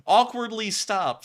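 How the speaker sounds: mocking awkwardly. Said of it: "That was not the word awkward".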